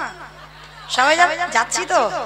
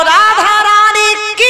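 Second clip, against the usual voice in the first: higher, slow, drawn-out rallying cry; loud devotional call; 4 semitones higher, slower than usual. Shown as a demonstration. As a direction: stretch it out high for the crowd to answer. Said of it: "একটু জোরে বলো".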